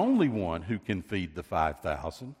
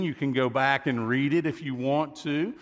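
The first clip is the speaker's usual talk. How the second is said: usual speaking voice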